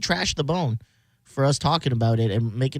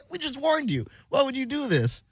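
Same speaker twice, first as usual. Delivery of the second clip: mock scolding; 10 semitones higher, 4 dB quieter than usual. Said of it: "They're like".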